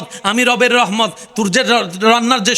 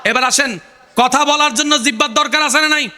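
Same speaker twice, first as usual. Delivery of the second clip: ordinary talk, nothing marked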